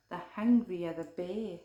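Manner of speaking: plain reading voice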